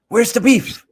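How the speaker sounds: loud, high-pitched mock ad-slogan yell; joking catchphrase shout. Joking with coworkers over the fence, high playful shout. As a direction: belt out the slogan as a gag.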